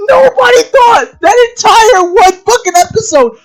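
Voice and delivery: loud, high, sobbing-edged yelling; furious rant. Real, not acted. Ranting at the writers, loud and high with a near-sobbing edge. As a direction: yell it out in real exasperation.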